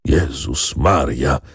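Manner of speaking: slow, low groan of dismay. A narrator's reading. Grandfather hearing of the revolt, groaned exclamation. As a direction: draw it out low, moaning. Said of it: "jęknął pan Maciej".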